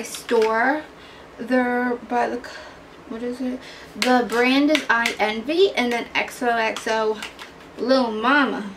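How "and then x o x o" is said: usual speaking voice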